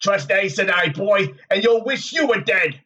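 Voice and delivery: sardonic warning. A narrator's reading. Tom's apparition warning Rand to run, sardonic. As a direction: goad him with warnings, rising to an urgent cry. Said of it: "Tom grinned at him sardonically".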